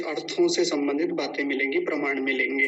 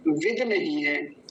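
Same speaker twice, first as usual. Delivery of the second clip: ordinary talk, nothing marked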